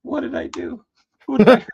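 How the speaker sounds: high, dramatic wail; mock despair. Hammed-up woe. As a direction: painter lamenting the late delivery in mock despair. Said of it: "I'm like".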